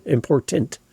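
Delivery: "Important" with both T's crisp and clear; T's enunciated. Shown as a demonstration. Coach showing the T is there for a reason, carefully enunciated. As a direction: land firmly on every T in "Important". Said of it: "The T's there for a reason".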